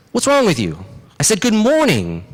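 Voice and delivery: high-pitched, indignant demand; acted irritation, rising in pitch; hot-headed rabbit. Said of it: "who has a little hot-headed".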